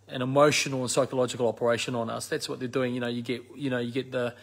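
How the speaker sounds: normal talking voice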